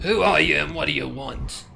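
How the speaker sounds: stern demand. Acted. Pony demands at the door.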